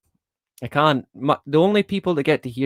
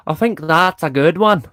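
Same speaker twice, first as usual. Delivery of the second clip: light Irish accent, a bit higher and louder; 4 semitones higher, 5 dB louder than usual. Shown as a demonstration. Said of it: "That's my Irish accent".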